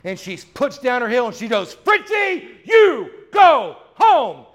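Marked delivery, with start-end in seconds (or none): 1.7-4.5 high, loud stamping shout at the dog; furious owner yelling at her yapping dog; frustrated shout. Retold or imitated. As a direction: put your foot down and yell the dog home. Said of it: "she finally gets frustrated".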